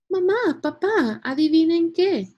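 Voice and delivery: put-on high, loud excited kid calling out; excited child. A narrator's reading. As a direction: burst in with big news for Mom and Dad. Excited child calls parents with news.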